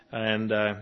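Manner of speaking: ordinary talk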